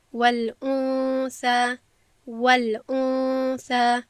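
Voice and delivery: والانثى drawn out very slowly, nun nasal and hidden; slow Quranic والانثى; ikhfa recitation. Shown as a demonstration. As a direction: chant والانثى with a long ghunna. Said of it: "باید بگویم".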